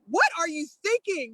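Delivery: high-pitched retold scolding; barking him down. Friend scolds the officer into backing down, barking. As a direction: imitate a friend telling someone off. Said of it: "she literally barked him down".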